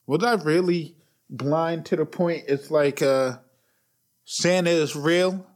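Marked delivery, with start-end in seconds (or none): none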